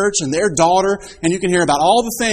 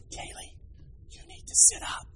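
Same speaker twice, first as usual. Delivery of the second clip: whispered scolding in church; whispered correction, voiceless; hushed whisper; 14 dB quieter than usual. Retold or imitated. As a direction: whisper it like a strict big sister. Older sister corrects in church.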